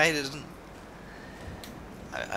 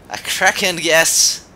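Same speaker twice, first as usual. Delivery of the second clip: loud, slow and higher-pitched; pun exclamation; 4 semitones higher, 8 dB louder, slower than usual. Shown as a demonstration. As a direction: proclaim the pun with drawn-out relish. Seizes on own accidental pun.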